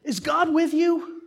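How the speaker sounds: put-on high, slow, loud little-boy scolding; child scolding. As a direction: lecture the brother like a tiny preacher. Little brother scolding from bunk.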